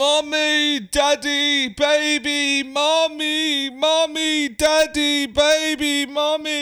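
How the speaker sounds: shrieked high and loud, hammed-up; mock screaming. Man shows the host what he screams while meditating, high hammed-up shriek. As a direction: scream the words like a wailing child in a trance. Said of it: "So I might say".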